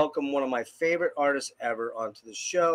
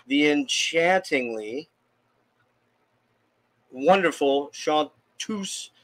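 grand announcer intro; much slower than usual. Acted.

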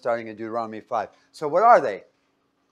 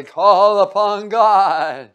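loud, drawn-out ceremonial call; mock emphatic invocation; 5 semitones higher, 10 dB louder, much slower than usual. Worshippers at rituals calling upon God, mock emphatic. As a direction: intone it grandly, stretching the words. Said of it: "say it in such an emphatic voice".

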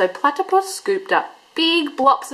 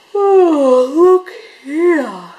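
yawning; 5 semitones higher, 8 dB louder, much slower than usual. A narrator's reading. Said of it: "he yawned".

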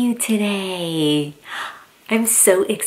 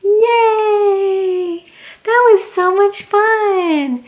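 excited; 11 semitones higher, slower than usual. Real, not acted. Cheering at the story's end.

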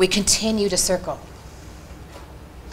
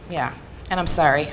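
ordinary talk, nothing marked

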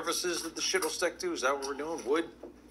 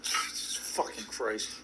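exasperated mutter; 6 semitones lower, 5 dB quieter, slower than usual. Real, not acted. Exasperated after being startled.

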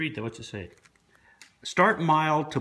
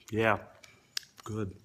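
chewing while talking; muffled by food; mouth full; 6 semitones lower, 5 dB quieter than usual. Real, not acted. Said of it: "talking with my mouth full".

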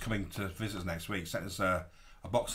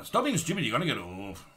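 mock French accent, pitch jumping about; bad French accent; 3 semitones higher than usual. Imitating a film's stuck tourist, bad French accent. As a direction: put on a terrible French accent. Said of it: "doing a really bad French accent".

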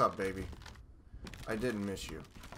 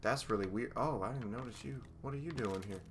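normal talking voice

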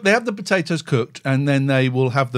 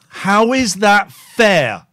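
slow, loud indignant question; mock rant; 4 semitones higher, 5 dB louder, much slower than usual. Mock-angry ranter complaining about the city, slow and loud. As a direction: drag it out in fake outrage.

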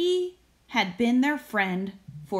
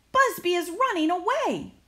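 put-on excited children; 9 semitones higher than usual. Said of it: "the children shouted".

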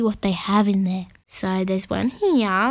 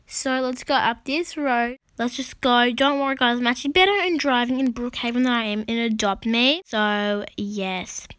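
normal talking voice

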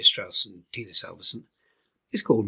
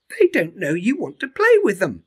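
high, bright cheerful bird voice; a friendly bird cheering him on; bird Iris; 9 semitones higher, 9 dB louder than usual. Acted.

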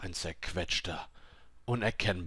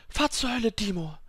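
high, tense curse; angry defiance; 13 semitones higher, 3 dB louder than usual. A narrator's reading. Dying woman cursing her invisible attacker, high-pitched defiance. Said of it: "dachte sie gegenüber dem unsichtbaren Angreifer".

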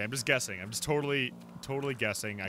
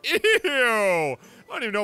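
disgusted; 9 semitones higher, 7 dB louder than usual. Real, not acted. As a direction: squeal as if grossed out. Disgusted by article's contents.

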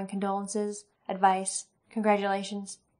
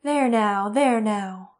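soothing; 6 dB louder, much slower than usual. Acted. Elaine soothing crying Katrina.

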